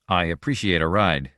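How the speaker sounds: measured, careful politeness. A narrator's reading. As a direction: choose each word with care. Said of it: "Conger said carefully".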